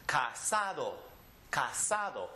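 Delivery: "casado" with the stress on "sa"; teacher models "casado", emphasizing the next-to-last syllable. Shown as a demonstration. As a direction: lean on the middle "sa" in "casado". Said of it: "the next to last".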